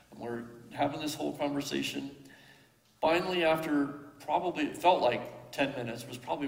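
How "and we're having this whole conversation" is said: usual speaking voice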